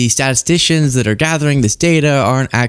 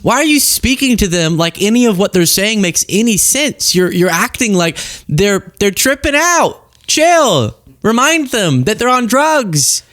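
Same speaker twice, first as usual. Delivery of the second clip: exasperated onlooker; 6 semitones higher than usual. Retold or imitated. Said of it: "you'd be like".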